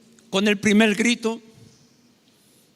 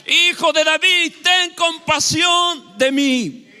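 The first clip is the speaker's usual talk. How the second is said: high, loud, long-drawn cry; shouting more forcefully; 8 semitones higher, 5 dB louder, much slower than usual. Acted. Beggar refusing to be silenced calls to Jesus, shouting. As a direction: stretch out a desperate plea at full voice. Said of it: "se puso a gritar con más fuerza".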